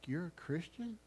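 usual speaking voice

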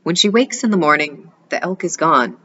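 ordinary delivery

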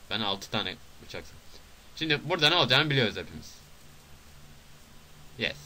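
usual speaking voice

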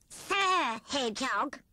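bow and scrape with the voice; humbly. Acted. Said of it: "said Rat humbly".

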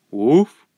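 short loud bark; imitated dog bark; dog's woof. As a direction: give one loud woof. Dog agrees.

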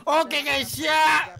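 loud hyped greeting to viewers; streamer intro. Acted. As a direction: call out to the stream audience. (sapaan streamer yang heboh)

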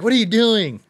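high, loud startled protest; mock alarm. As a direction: play someone shocked at being groped. Acted reaction of attacker being groped.

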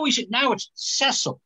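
normal talking voice

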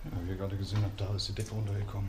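hushed, tense whisper while exploring; nervous night vlog whisper. Real, not acted. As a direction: keep it whispered, wary of noise. Continues quiet exploring narration.

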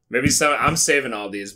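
ordinary delivery

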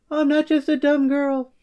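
high put-on mom voice; imitating mom. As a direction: mimic your mom, indignant. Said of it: "my mom would be like".

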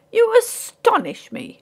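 very high, drawn-out amazement; astonished exclamation. A narrator's reading. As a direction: swoop high, slowly, in amazement. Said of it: "exclaimed Ray".

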